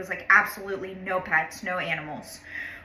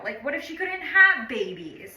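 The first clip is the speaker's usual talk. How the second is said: usual speaking voice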